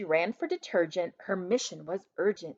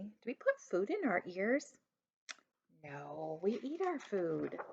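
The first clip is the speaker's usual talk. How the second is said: teaching aside; 9 dB quieter than usual. Real, not acted. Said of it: "teacher question to children".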